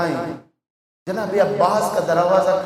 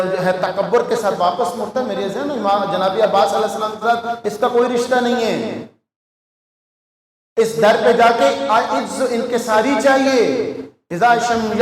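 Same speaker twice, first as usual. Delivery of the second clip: ordinary talk, nothing marked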